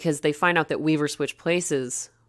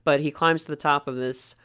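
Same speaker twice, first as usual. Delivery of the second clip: ordinary delivery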